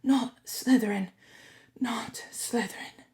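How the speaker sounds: fading to an unvoiced whisper; pleading thought. A narrator's reading. Harry pleading with the hat, fading whisper. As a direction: beg silently, dropping to a whisper.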